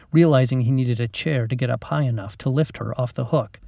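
ordinary delivery, nothing marked

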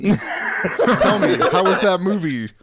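put-on high, loud, laughing old-timer voice; old man voice. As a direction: croak it like an ancient grandpa. Teasing him as eight thousand years old.

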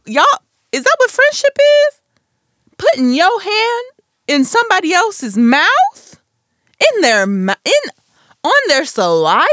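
loud, high, incredulous questioning; appalled disbelief. Real, not acted. Podcaster appalled by fingers in friends' mouths, loud incredulous disbelief.

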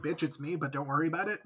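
softly smug confession; sly soft aside. Retold or imitated. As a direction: murmur it like a secret. Imagined Amos reveal.

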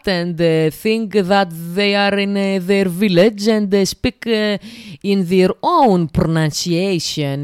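put-on teasing teachers who speak English with a strong Greek accent; mock Greek-accented English. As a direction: mimic a teacher stuck in a thick Greek accent. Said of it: "who speak like that".

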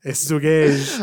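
"الزقاج" بقاف بدل الجيم الأولى وجيم في الآخر; قل "الزقاج" مثل مدرس الجيولوجيا; تقليد نطق المدرس. Retold or imitated. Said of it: "هذا فول ديلوكس". (تقليد مدرس الجيولوجيا وهو ينطق "الزقاج")

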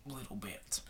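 whispered under breath. Real, not acted. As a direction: drop to a whisper. Whispered repeat of the phrase.

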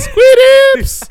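high, loud mock battle cry; comic yell. Retelling a player's step-back three, high yell. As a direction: yell it out like a silly catchphrase. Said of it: "then he yelled Squid Ips".

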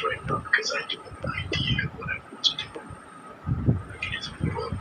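very quiet, almost inaudible; barely audible. Real, not acted. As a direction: speak so softly it barely carries. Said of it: "your voice is very quiet".

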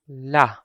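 "la" with an ordinary, open A; a plain-length "la"; normal vowel. Shown as a demonstration. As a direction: say "la" without prolonging or stopping it. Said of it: "normal vowel sound".

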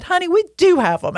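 high, bright-toned imitation of the waitress; mock waitress breaking the good news. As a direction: pitch up to play the friendly waitress. Retold waitress delivering good news.

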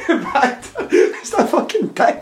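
high, slow words through laughter; laughing. Real, not acted. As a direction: laugh helplessly while getting it out. Laughing at own rant about Tori.

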